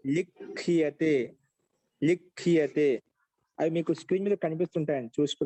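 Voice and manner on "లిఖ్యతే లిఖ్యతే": very slow, separated syllables; slow dictation. Shown as a demonstration. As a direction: say it slowly enough to write down. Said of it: "స్లోగా చెప్పరా". (నెమ్మదిగా విడదీసి పలకడం)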